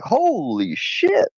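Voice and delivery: slow, drawn-out mock dog voice; a lightly voiced startled dog; dog's startled thought. Dog Brownie reacting to the jumpy cat, slow mock surprise. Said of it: "Brownie backed up like".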